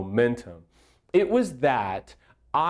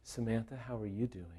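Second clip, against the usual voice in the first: hushed, much softer careful question; changed, careful tone; 3 semitones lower, 15 dB quieter, faster than usual. Retold or imitated. Counselor carefully checks on the hurting wife, hushed. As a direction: drop right down, asking very gently. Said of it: "his tone would change with Samantha".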